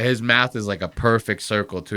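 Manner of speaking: ordinary talk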